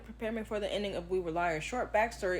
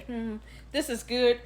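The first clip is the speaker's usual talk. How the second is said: slow, thoughtful appraisal; musing hmm; slower than usual. Retold or imitated. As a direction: weigh it up slowly, half convinced. Musing while reading the book.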